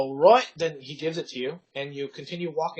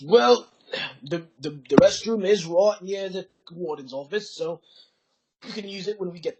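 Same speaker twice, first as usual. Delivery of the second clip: guard stalling through a raised-voice reply; guard answering; 3 semitones higher, 7 dB louder than usual. Acted. Guard answers request for restroom.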